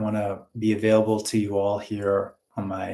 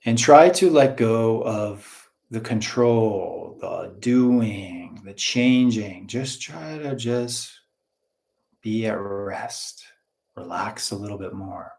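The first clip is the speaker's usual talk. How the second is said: guided relaxation; slower than usual. Real, not acted. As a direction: ease them slowly into letting go. Leads a relaxation exercise.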